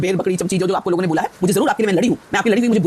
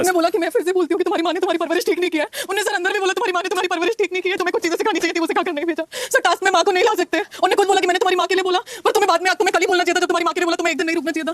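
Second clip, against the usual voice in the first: tearful, raised pitch; 8 semitones higher than usual. Real, not acted. Upset woman defends herself after crying.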